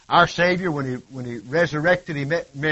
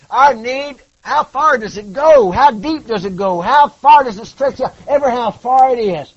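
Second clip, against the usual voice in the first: slow, then louder and higher questions; rising preaching; 5 semitones higher, 8 dB louder, slower than usual. Acted. Preacher pressing the congregation, building louder.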